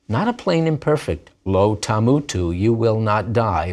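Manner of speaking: usual speaking voice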